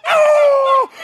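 high, loud "oh!" of shock; shocked cry. Retold or imitated. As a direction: relive the shock with a big yelp. Acting out shocked reaction to match.

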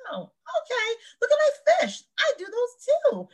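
high breezy imitation of a castmate; high mock Sonya voice. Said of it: "And she was like".

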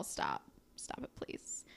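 low, dropping to a whisper; mock pleading under the breath; whispered begging to stop; hushed plea. Acted plea to friend to stop.

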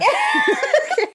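squealing laugh; high laugh. Real, not acted. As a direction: laugh at yourself. Laughing at own voice.

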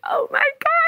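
very high, squeaky retold amazement; starstruck shock. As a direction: squeal it small, reliving the shock. Retold reaction after the call.

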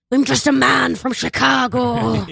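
slow, mock Irish accent; an Irish action hero badly passing as American; Liam Neeson. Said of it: "refuses to do a not Irish accent".

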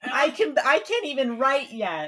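put-on exasperated kid. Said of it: "And I'm like".

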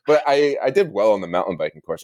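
normal talking voice

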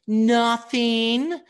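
put-on high, loud sulky child's "Nothing"; bratty kid. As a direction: snap it like a spoiled eight-year-old. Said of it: "And the kid goes".

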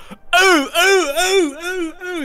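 high, loud cartoon cries of pain; shrill series of "oh" cries; knocked-flying cries. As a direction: yelp repeatedly as you fly through the air. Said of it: "his character Bland just goes".

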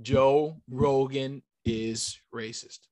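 very slow, word by word; deliberate prompting. Shown as a demonstration. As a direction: spell it out slowly for someone to say along. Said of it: "I'll, I'll say it with you".

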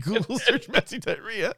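quoting while breaking into laughter; laughing through quote. Retold or imitated. Host quotes the sketch to his co-host, laughing through it. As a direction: giggle your way through the line.

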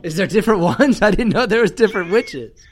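laughing in disbelief. Real, not acted. Amused disbelief about witches.

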